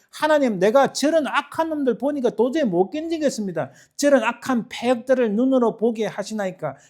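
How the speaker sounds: high, loud desperate complaint to God; agitated protest. Acted. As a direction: plead loudly as if unable to bear it. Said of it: "하고 일어나서 막 방방 뛰면서".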